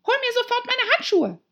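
high, loud commanding squawk; shouted order. A narrator's reading. Rabbit demanding gloves at once, high imperious shout. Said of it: "rief die Stimme".

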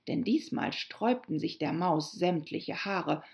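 ordinary delivery

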